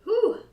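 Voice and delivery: loud, high breathless whew; winded exhale. Real, not acted. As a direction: blow out air, out of breath. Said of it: "I'm getting very winded".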